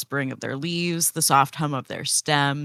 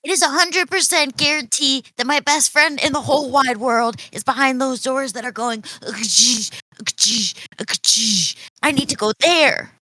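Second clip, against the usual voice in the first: high, excited character voice; urgent insistence; 8 semitones higher than usual. Acted. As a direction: insist your best friend is behind those doors. Leah insists her friend is there.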